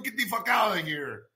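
ordinary delivery

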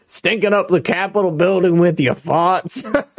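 mock the caller like a cartoon rooster; laughing Foghorn Leghorn. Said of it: "Sounds like Foghorn Leghorn".

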